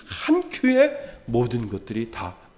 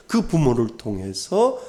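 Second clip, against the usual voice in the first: ordinary talk, nothing marked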